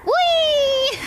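gleeful whee. Real, not acted. Joyride spin in a car.

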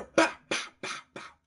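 loud mouthed gunshots; gunshot noises. Retold or imitated. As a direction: spit out pow pow like shots. Imitating gunshots.

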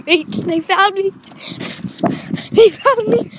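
high, loud alarmed cry; panicked yell. Real, not acted. Caught sneaking by his parents, panicked yell. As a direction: shout out in panic at being caught.

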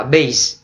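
"bass" pronounced like "base"; long A for sound. Shown as a demonstration. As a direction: say "bass" as BAYSS. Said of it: "nói nó là cái âm bass".

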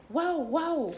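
high, loud dog barking. Acted. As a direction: bark up on two legs. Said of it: "en dos patas y ladra". (ladrido agudo y fuerte)

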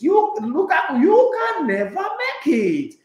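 high, loud, slow put-down; mock Henry verdict. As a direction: spell out the real meaning with scorn. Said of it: "what he's actually saying is".

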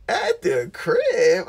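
high, loud, drawn-out disbelief; slow, high-pitched incredulous question; incredulous echo. Retold or imitated. Echoing her answer in disbelief.

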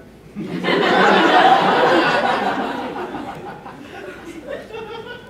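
airy wheezing laugh; breathless laugh. Real, not acted. Laughing at Maka joke.

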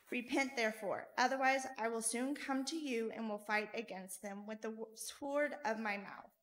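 normal talking voice

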